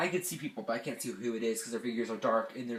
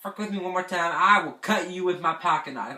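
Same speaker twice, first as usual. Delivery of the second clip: loud, higher-pitched retold threat; angry threat; 5 semitones higher, 6 dB louder than usual. Camper threatening the boys who sprayed them, loud mock-furious warning. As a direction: warn them loudly, half laughing by the end. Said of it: "I was not joking".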